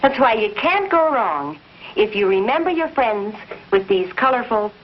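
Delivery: ordinary talk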